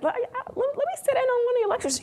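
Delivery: lightly voiced senior colleague; colleague's offer. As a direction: offer to sit in, briskly. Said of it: "he said".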